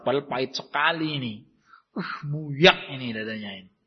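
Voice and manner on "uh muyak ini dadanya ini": pained groan. Acted. Sufferer's chest aches with bitterness.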